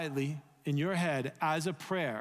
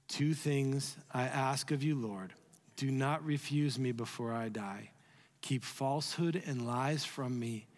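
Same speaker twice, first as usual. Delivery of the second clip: slow congregational reading; 4 semitones lower than usual. Shown as a demonstration. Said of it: "Let's just read it one time together".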